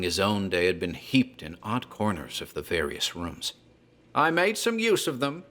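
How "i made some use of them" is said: put-on a much higher, strained old man's voice; old Whately. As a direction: voice the old grandfather, pitched way up. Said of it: "he'd say".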